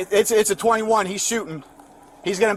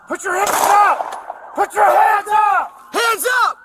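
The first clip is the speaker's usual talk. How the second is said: shouted commands, high and urgent; shouting; 10 semitones higher, slower than usual. Real, not acted. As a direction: scream orders at a gunman. Officers shouting at armed suspect.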